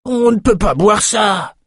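high, slow, outraged cry; man's outcry. Acted. A soldier crying out over the muddy water, high and slow. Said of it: "s'écria un homme".

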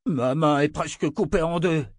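wounded soldier raging at his cold leader; angry outburst. Acted. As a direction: explode at her indifference. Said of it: "explosa l'autre blessé".

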